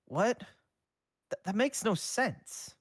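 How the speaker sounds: baffled, caught off guard; teen confused by his mentor, high and incredulous. A narrator's reading. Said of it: "scrunched his brow in confusion".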